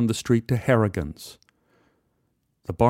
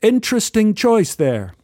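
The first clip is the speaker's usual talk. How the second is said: lightly English, higher and louder; a polite English gent's greeting; English accent; 10 semitones higher, 4 dB louder than usual. Retold or imitated. English stranger greets narrator at the bar, lightly English. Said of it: "He, he was English".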